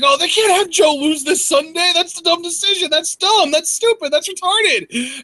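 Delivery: high-pitched whiny fan voice; mocking upset fan. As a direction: mimic a frantic fan. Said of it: "the Lex man is freaking out".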